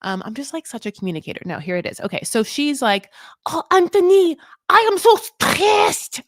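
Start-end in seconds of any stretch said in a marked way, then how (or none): 3.4-6.2 high, loud, slow put-on voice for stressed; mock Manon fretting, much higher; imitating Manon. Host mimics Manon complaining to Anthony, high and drawn out. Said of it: "I cannot tell you how she says stressed".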